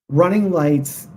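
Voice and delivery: ordinary delivery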